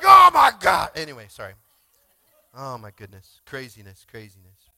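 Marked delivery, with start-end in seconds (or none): 0.0-0.9 loud, shouted oh my God; a loud shocked outburst; retold exclamation. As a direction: blurt it out at full volume. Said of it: "I was like".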